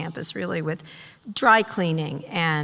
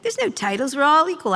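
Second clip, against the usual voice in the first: higher, louder mock-earnest boss voice; mocking impression; 7 semitones higher, 4 dB louder than usual. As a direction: parody their smug claim of equality. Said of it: "That was my, like".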